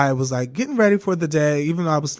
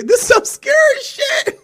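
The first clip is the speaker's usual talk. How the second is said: very high, wailing fright; mock scared cry; 5 dB louder than usual. Staring at herself in the mirror, high frightened wail. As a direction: squeal it in a hammed-up panic.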